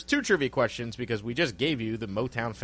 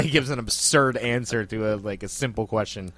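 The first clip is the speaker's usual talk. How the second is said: ordinary talk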